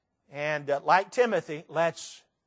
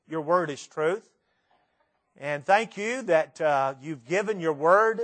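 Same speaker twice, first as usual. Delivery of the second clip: earnest prayer, sinking lower toward the amen; closing prayer; 3 semitones lower than usual. Real, not acted. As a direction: lead the congregation in sincere, measured prayer.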